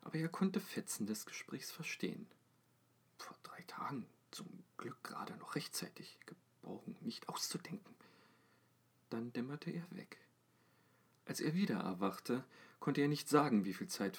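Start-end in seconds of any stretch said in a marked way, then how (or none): 3.2-8.0 faint whispered snatches of talk; hushed distant voices. A narrator's reading. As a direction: whisper it like overheard from afar. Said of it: "Sie unterhielten sich leise".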